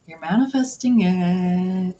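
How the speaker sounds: usual speaking voice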